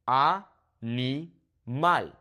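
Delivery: Animal broken slowly into ah, nee, mahl; very slow Spanish "Animal", a pause between syllables. Shown as a demonstration. Teacher breaks the word up for learners, slow Spanish syllables of Animal. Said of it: "break it up into syllables".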